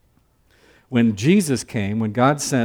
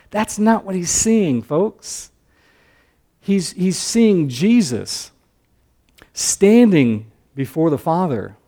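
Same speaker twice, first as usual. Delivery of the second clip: higher, slow, impassioned sermon voice; preaching at full force; 4 semitones higher, 4 dB louder, slower than usual. Acted. Pastor urging folks to see Jesus, impassioned preaching. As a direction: lift the congregation with the vision.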